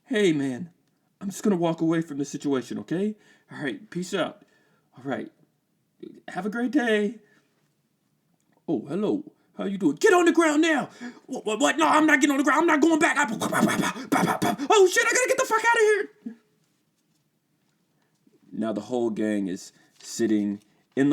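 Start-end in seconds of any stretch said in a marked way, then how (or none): 0.0-7.2 high, fast voice backing away; nervous teen. Acted. 8.7-10.9 high, loud barked order; polite then suddenly commanding; cop shout. Acted. 11.3-16.4 very high, loud, fast panic; panicked yell. Acted.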